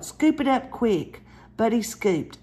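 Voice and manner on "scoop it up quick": high, hurried call to scoop; a mild mock yell; urgent yell. A narrator's reading. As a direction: tell him to grab it now. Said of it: "Mama yelled".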